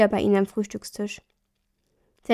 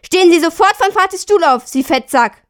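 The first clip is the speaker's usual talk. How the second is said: put-on high, loud outburst of boyhood rage; furious shout; 7 semitones higher, 9 dB louder than usual. A narrator's reading. Boy yelling at his stepfather-to-be, furious shout. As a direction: scream it at the man in father's chair. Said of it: "Er konnte seinen Zorn nicht mehr zügeln".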